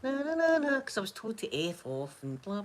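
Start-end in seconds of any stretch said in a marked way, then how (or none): 0.0-0.8 high, sung-out "na na" violin; mock violin noise. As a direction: imitate a tiny violin in mock self-pity. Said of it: "My wee violin going".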